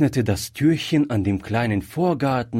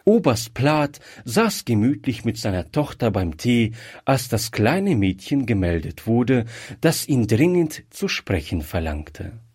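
usual speaking voice

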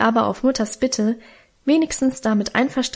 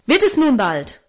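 higher, sharp angry call; angry father; 4 semitones higher than usual. A narrator's reading. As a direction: shout at the children in anger. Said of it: "rief er zornig".

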